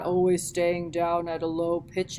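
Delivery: pitched low as an example; sit down low in your voice; low pitch demo. Shown as a demonstration. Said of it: "variety of your vocal pitch".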